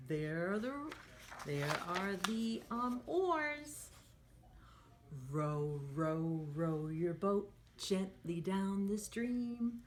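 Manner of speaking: sing-song storybook read for little ones; lively picture-book voice; reading to children. Acted. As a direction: read it like a teacher at story time. Teacher reads picture book aloud.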